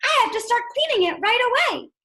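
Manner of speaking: spring into action, keen to clean; eager resolve. Pretend. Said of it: "Did he jump out of bed and say".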